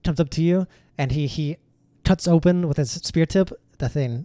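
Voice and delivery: normal talking voice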